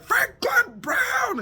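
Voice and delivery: high, slow barked name like a soldier at attention; army-style shout. Retold or imitated. Sleepwalking son answers like a soldier at attention, high slow shout. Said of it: "he says everything like he's in the army".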